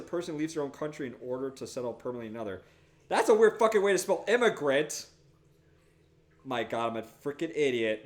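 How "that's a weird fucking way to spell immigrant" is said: exasperated outburst. Real, not acted. Surprised at own misspelling.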